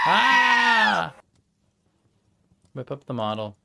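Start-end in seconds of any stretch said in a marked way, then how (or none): 0.0-1.1 startled shout. Real, not acted. Sudden startled outburst.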